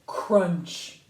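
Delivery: a sudden, punchy CRUNCH; loud crunch. A narrator's reading. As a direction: hit the sound word hard and loud. Sudden crushing moment in story.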